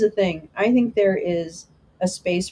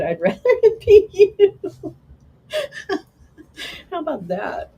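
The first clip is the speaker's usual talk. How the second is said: high, laughing through the words; 12 semitones higher, 5 dB louder than usual. Real, not acted. Streamer laughing at a chat comment. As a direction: break into giggles mid-sentence.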